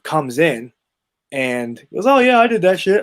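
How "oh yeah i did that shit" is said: cocky teammate. Retold or imitated. Teammate admits breaking ribs.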